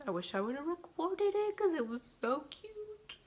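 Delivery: high-pitched cute gushing; melting over something adorable, voice rising high. Real, not acted. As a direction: let the pitch climb as you gush.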